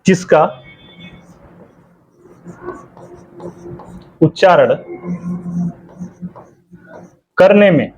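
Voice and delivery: words spaced out with long pauses for writing. Shown as a demonstration. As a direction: dictate it to the class word by word. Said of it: "teacher dictating notes". (रुक-रुक कर लिखवाना)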